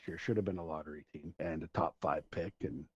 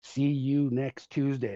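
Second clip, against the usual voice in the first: mom yelling across the stands, higher and drawn out; mock yell building louder and slower; retold yell; 5 semitones higher, 5 dB louder, slower than usual. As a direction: imitate an angry fan's holler. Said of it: "just yelled at her".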